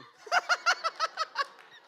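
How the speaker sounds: big shrieking burst of laughter; loud high cackle. Real, not acted. Pastor reacting to scripture, loud high cackle. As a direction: let out a squealing laugh. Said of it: "Y'all heard that laugh".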